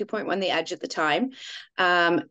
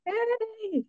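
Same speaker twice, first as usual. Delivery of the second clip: cheer; 14 semitones higher, 5 dB quieter than usual. Real, not acted. Cheering announcement.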